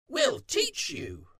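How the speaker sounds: very high, slow, drawn-out voice; high, slow character voice; crow and fox offer. A narrator's reading. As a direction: offer it eagerly, stretching the words.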